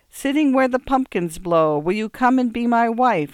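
put-on high, loud recitation in an English accent. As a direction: read to a child in an English accent. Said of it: "the pure accents of the Sweeney's nurse".

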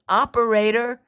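Operator shouted high and loud; urgent shout. Acted. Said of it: "she called".

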